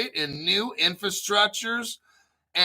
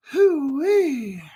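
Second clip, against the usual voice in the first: excited whoop; 9 semitones higher, 4 dB louder than usual. Real, not acted. Excited cheer over memberships.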